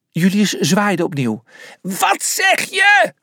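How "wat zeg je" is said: slow, high long-distance yell; shout. A narrator's reading. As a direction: holler each word drawn out to carry far. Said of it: "schreeuwde hij".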